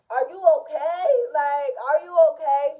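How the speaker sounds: acted-out concern, voice lifted; alarmed check. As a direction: retell it as an urgent, loud check-in. Acting out alarmed check on cousin.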